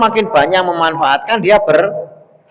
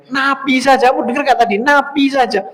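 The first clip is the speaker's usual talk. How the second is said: high-pitched, exasperated mock telling-off; retold scolding; 11 semitones higher than usual. Host berates his messenger for inviting everyone, retold scolding. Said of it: "utusannya diomeli".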